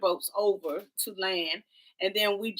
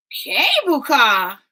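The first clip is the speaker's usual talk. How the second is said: startled disbelief; 4 semitones higher, 7 dB louder, much slower than usual. Retold or imitated. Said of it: "Oh, you gotta take the cable car".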